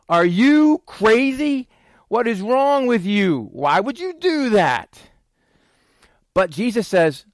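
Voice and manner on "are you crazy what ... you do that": high, drawn-out, incredulous outburst; acted exasperation. Pastor voicing what people deserve to hear, exasperated. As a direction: stretch it out, raised in mock outrage. Acting out outrage at someone.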